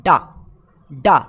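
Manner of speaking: डा said low and loud, a clipped da; short da sound; phonic d sound. Shown as a demonstration. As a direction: drill डा twice. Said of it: "डी को हम लोग क्या पढ़ते हैं".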